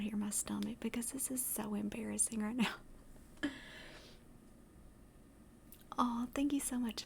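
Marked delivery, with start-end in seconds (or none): 2.7-4.4 small breathy embarrassed laugh. Real, not acted. Streamer embarrassed by a rumbling stomach, quiet laugh.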